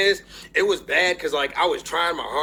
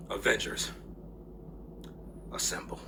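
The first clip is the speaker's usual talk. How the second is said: hushed movie quote; 7 semitones lower, 12 dB quieter than usual. Retold or imitated. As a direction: breathe the line out in awe. Captain America's famous line retold.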